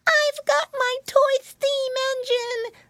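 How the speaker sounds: very high excited piglet; slow, squealed child boast; Flop Ear. A narrator's reading. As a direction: cry out like a thrilled little boy. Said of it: "cried Flop Ear".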